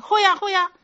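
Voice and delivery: hammed-up eager yes-man; eager agreement. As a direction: play the convinced listener nodding along. Imitating a listener eagerly agreeing.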